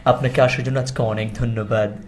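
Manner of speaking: mock formal host. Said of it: "ওর উপস্থাপনার style দেখে আমি খুব অবাক হই".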